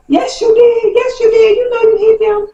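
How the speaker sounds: put-on sing-song accusation like small children; mock kids yelling. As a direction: kids chanting yes you did. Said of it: "Jody kids are yelling".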